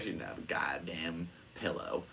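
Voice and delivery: hushed, disbelieving whisper; low conspiratorial aside. Real, not acted. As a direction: drop to a whisper for the punchline. Exasperated aside about unprepared friend.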